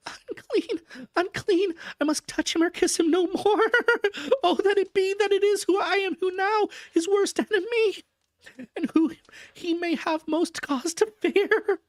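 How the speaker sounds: anguished Mina. A narrator's reading. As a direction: cry out in self-horror. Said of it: "whispered, amidst choking sobs".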